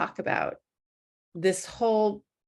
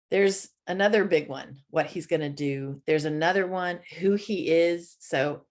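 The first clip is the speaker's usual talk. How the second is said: usual speaking voice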